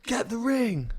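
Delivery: high-pitched mock wife voice; imitating wife. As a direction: mimic a partner's high urgent order. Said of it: "And she goes".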